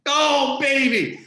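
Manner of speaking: thrilled exclamation; delighted. Real, not acted. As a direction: cry out with gleeful delight. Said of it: "Isn't that great?".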